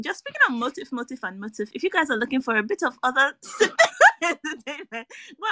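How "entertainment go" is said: words squeezed out through softer laughter; laughing recommendation. Real, not acted. Host pointing listeners to another film, laughing recommendation. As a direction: keep giggling as you recommend it.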